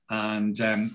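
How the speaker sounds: usual speaking voice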